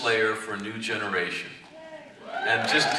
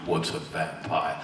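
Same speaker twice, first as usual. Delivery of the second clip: slow, lower, baffled; lightly voiced puzzled god; 8 semitones lower than usual. Zamasu puzzled by Spike, slow and lower. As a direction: ask as if the word means nothing. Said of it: "What would that be like".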